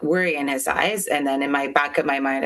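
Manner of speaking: normal talking voice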